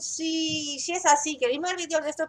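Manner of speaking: bright, upbeat sign-off; vlog outro. Acted. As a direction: wrap up cheerfully for viewers. Youtuber outro.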